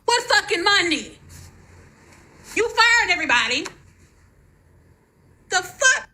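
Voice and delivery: high, cracking furious disbelief; real anger close to tears; really mad. Real, not acted. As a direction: spit it out in real rage, pitched high. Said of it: "I'm so fucking mad right now".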